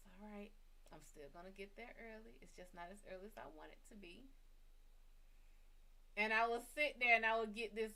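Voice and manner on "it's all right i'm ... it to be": soft strained reassurance to herself; self-calming murmur. Retold or imitated. Talking herself down at Starbucks, soft strained murmur. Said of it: "I stopped and was like".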